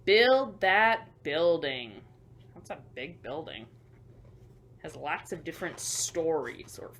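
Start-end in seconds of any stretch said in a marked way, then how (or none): 0.0-2.0 louder, slow drawn-out command; slow loud call-out. Acted. To the children, a slow, loud construction order. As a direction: bellow it slowly like a site boss.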